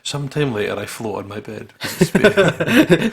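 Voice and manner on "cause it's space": drawn-out, laughing high and loud. Real, not acted. Friends joking about floating in space, laughing. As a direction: laugh the words out slowly.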